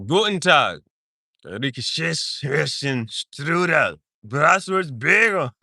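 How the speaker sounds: hammed-up German gibberish; mock German accent. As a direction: exaggerate German words, ending slow and hard. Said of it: "with the German".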